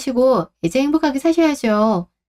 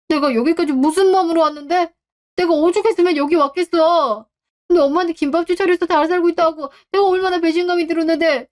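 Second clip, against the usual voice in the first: higher, self-pitying protest; aggrieved outburst; 6 semitones higher than usual. Acted. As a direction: vent the sense of betrayal. Son complains of betrayal.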